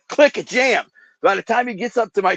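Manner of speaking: high, loud burst on click; tense retelling. Retold or imitated. Telling friends how the gun jammed at his chest, tense and loud. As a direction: spit out the click as the gun fails.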